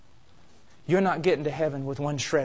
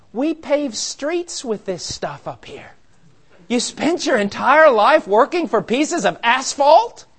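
slow, higher, louder disbelieving angel; mock angel; 6 semitones higher, 5 dB louder, slower than usual. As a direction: marvel at the fool, stretching it out. Angel scoffing at the gold.

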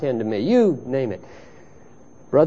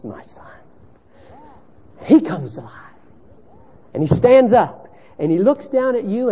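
hushed storytelling. Acted. Quiet parable storytelling.

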